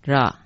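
"ra" as one open syllable; pronounced ra. Shown as a demonstration. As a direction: say "ra" as romanized R-A. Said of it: "这个字念成".

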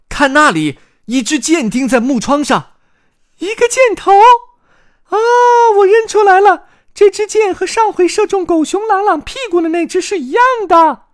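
high, loud chick voice; excited shouting. Acted. Chick Carmen spots the arrow, excited high shout. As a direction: cry out the discovery, high and loud. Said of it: "卡门兴奋地喊道".

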